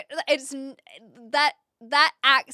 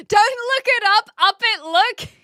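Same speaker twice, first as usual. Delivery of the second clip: high, loud, fast mock motivational line; hammed-up guru parody, higher and louder; 9 semitones higher, 9 dB louder than usual. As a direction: spoof the guru saying. Parodying the quote.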